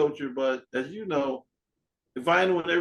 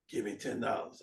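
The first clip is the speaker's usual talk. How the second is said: lower, much softer, offhand voice; seller's low mutter; 7 semitones lower, 11 dB quieter than usual. Retold or imitated. Porch seller naming his price, low and quiet. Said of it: "He goes".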